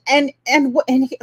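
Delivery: stumbling over false starts; stuttering. Real, not acted. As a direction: trip over the opening words and restart. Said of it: "sorry, I'm stuttering".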